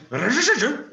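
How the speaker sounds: strained buzzing rolled R, tongue to the roof; attempted Czech ř, loud and high; a loud, rough, strangled ř sound; rasping R demo. Shown as a demonstration. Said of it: "The closest I've got is the sound".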